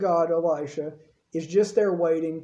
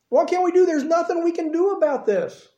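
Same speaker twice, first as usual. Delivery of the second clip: high, louder desperate cry; panicked servant; 8 semitones higher, 3 dB louder than usual. Acted. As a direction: plead in mock terror.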